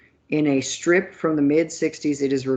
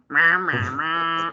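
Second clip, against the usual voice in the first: Charlie Brown teacher; slower than usual. Pretend. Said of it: "teaching Charlie Brown?".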